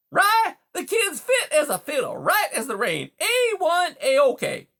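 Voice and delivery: high, loud, hearty beaver; brash assurance. A narrator's reading. Beaver assures the mouse that Rye is fine, loud and breezy. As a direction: reassure with booming, breezy confidence. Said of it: "Mister Conrad assured her".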